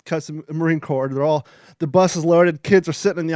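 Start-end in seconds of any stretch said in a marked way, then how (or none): none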